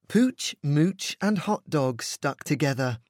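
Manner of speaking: usual speaking voice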